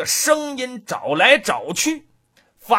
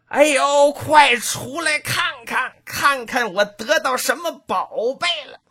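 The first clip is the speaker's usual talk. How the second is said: husband's shout; 5 semitones higher than usual. A narrator's reading. Husband shouting to his wife from outside the house. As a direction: holler for the wife to come out and look. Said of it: "听着她丈夫猫唠这一嗓子".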